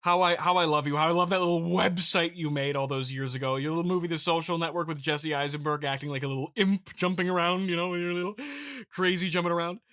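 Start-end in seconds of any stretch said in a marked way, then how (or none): none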